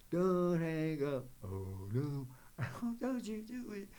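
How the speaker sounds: put-on pleading voice, half joking; playful mock plea. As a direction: beg the viewer to stay, hammed-up. Jokingly begs viewers not to leave.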